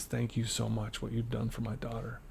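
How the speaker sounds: soft, close murmur of thanks; murmuring thanks close to his ear; quiet in my ear. Retold or imitated. Mom hugging him, whispering thanks right into his ear, softly. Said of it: "she's up in my ear".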